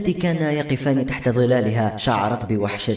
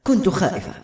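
higher-pitched, lightly acted tearful voice; a touch of crying in a raised pitch; tearful, choked; 7 semitones higher than usual. A narrator's reading. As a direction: confess it as if holding back tears. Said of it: "قالت بصوت يخنقه البكاء".

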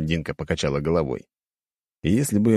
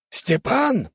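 high-pitched exclamation; crying out. A narrator's reading. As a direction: blurt the name out, pitch leaping up. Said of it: "выкрикнул тот".

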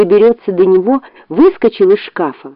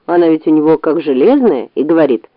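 usual speaking voice

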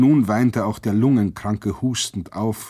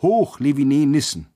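loud, higher rallying cry; shouted cheer; 5 semitones higher, 5 dB louder than usual. A narrator's reading. The whole prison cries from every window, shouting. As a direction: call it out for a whole building. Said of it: "das ganze Haus schrie".

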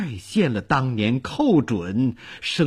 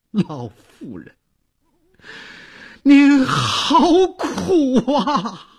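loud grieving wail; 13 semitones higher, 4 dB louder, slower than usual. Acted. Old Liu cries out to the late mistress, wailing. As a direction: wail it out slowly, high and anguished. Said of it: "刘婆放声大哭".

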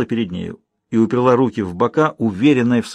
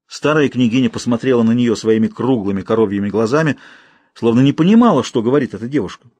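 plain reading voice

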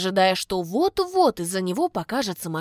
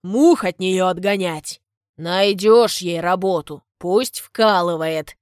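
gruff father; 6 dB louder, slower than usual. A narrator's reading. Father mocks daughter.

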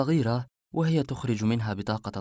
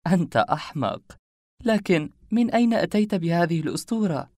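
higher, louder coquettish scolding; playfully scolding woman; 7 semitones higher, 4 dB louder than usual. A narrator's reading. Woman to the man who tricked her, playful higher scolding. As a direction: pitch it up for a teasing question. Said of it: "ضربتني بيدها بدلال أنثوي".

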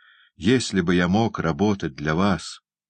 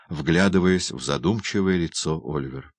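plain reading voice

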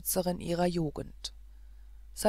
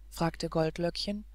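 ordinary delivery

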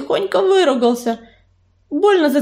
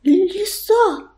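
higher, frightened gasp of a word; trembling fear; 6 semitones higher than usual. A narrator's reading. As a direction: say it through trembling lips. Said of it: "трясущимися губами выговорила Белочка". (испуганно, дрожащими губами)

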